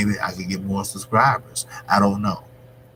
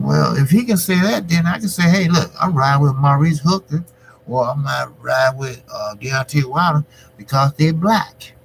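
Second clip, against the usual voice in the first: high, loud, worked-up retelling of his comeback; heated retort; 6 semitones higher, 4 dB louder than usual. Retold or imitated. Vlogger retelling his reply to critics, heated and high. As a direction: fire back at the critics, voice climbing high. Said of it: "And I said".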